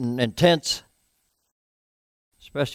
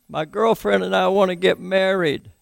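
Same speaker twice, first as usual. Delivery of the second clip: higher, imitating a drunk caller; drunk man's plea; 6 semitones higher than usual. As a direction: lightly play the tipsy caller begging. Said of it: "And he said".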